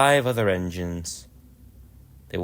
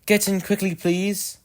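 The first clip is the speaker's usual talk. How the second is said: much higher, urgent call to board; eager engine calling; 11 semitones higher than usual. Acted. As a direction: hurry the passengers on. Edward calling passengers aboard.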